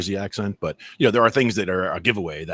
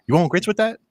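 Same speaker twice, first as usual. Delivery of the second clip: Southern waitress; 11 semitones higher, 6 dB louder than usual. Retold or imitated. Waitress asking mom if she wants grits, Southern accent. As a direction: ask it like a Southern diner waitress. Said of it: "the waitress is asking my mom".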